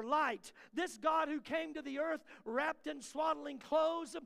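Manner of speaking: loud, fervent preaching with a shout; full-force preaching. Acted. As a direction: preach it out to the whole room.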